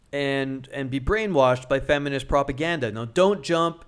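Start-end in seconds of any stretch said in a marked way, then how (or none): none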